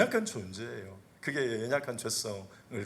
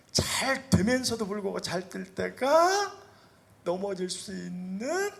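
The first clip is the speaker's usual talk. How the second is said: preaching emphasis; 8 semitones higher, 5 dB louder, slower than usual. Acted.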